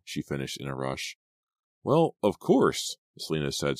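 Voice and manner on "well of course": slow, drawn-out mild surprise; surprised. A narrator's reading. A touch of surprise, slow and lifted. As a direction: Selena caught off guard by Allison's news, mildly surprised.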